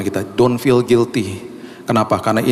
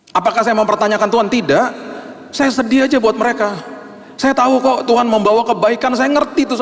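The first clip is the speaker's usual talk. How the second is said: high, intense confessional preaching; impassioned preaching; 9 semitones higher than usual. Acted. Preacher admits his faith faltered, impassioned. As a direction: open your heart to the church with force.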